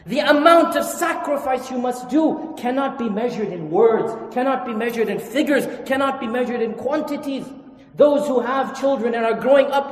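loud, emphatic sermon delivery; fiery preaching. Acted. Imam exhorting parents from the pulpit, loud and emphatic. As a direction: preach it out to the congregation.

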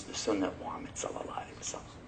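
hushed, fast aside; whispered. Acted. Preacher whispering the real reason to the crowd. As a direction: drop to a whisper for the last clause. Drops to a whisper at question end.

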